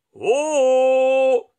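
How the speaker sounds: long, high, loud echoed "oh"; echoing shout. Acted. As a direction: answer like a well's echo, drawn out. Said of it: "gli fece eco in risposta". (eco prolungata e forte)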